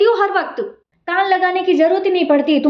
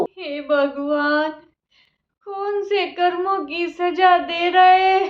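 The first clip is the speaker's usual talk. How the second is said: slow despairing lament; much slower than usual. Acted. Old mother lamenting her fate to God as they quarrel. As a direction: mourn it slowly, crushed.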